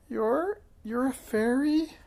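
very high, thin little squeak; high-pitched, squeaky disbelief. A narrator's reading. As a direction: squeak it out as a stunned question. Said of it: "hardly more than a squeak".

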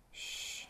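soft, voiceless shushing; hushing shh. A narrator's reading. Frances to friends as vapor rises, hushing. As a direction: hush them with a long shh.

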